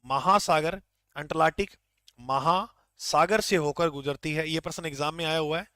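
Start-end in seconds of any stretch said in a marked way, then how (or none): 0.0-4.5 slow, word-by-word dictation for notes. Shown as a demonstration. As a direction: spell the fact out slowly so students can write it. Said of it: "ये प्रश्न fact वाला याद रखना है". (धीरे-धीरे लिखवाते हुए)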